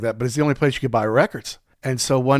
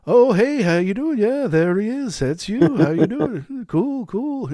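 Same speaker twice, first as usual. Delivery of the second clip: much higher put-on voice of a famous Beatle saying hello; [name] impression; 8 semitones higher, slower than usual. Comedian imitating the Beatle greeting him, higher voice. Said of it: "he did a great [name] impression".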